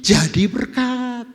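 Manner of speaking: jadi berkat stretched slow and loud; emphatic slow. Real, not acted. Preacher driving home the point to the congregation, slow and loud. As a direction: land "berkat" slowly and loudly.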